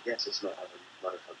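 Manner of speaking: ordinary talk, nothing marked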